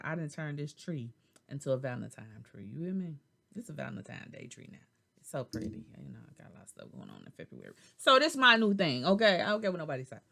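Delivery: ordinary talk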